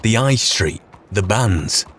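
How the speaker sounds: steady storytelling narrator voice; story narration. A narrator's reading. Yorkshire lad narrating his rise to Saint-Denis, storytelling voice.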